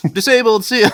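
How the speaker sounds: high, loud cheeky brush-off; mock taunt. Retelling a prank, a loud cheeky mock brush-off. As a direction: toss it off like a cheeky thief. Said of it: "being like".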